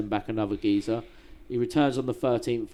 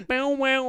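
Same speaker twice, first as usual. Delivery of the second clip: mock yelp; 13 semitones higher, 3 dB louder than usual. Imitating falling-apart Russian blocks.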